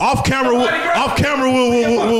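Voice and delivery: high, excited and laughing; bursting with eager excitement. Real, not acted. Excited hush about a secret.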